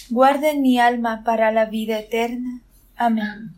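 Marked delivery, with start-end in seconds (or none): none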